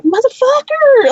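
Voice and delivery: high, slow, drawn-out mock cry; a high, stretched exclamation; exasperated outburst. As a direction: throw it out in disbelief. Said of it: "I'm like".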